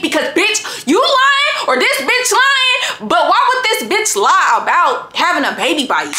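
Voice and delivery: shrill-high outraged accusation, slow on lying; telling the boyfriend one of them is lying, outraged; high outraged demand. Retold or imitated. Confronting boyfriend on phone.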